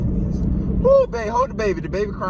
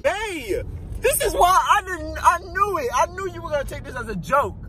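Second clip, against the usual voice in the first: shouting match; 11 semitones higher than usual. Real, not acted. Scolding partner, not a joke.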